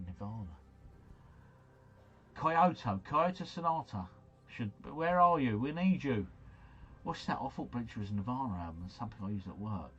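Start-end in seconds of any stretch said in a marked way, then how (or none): none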